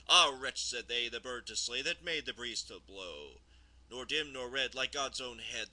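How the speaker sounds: loud, emphatic verse reading with a steady beat; poem recitation. Acted. Reader recites Rime of the Ancient Mariner, emphatic poem recitation. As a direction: declaim the verse line by line, falling at stanza ends.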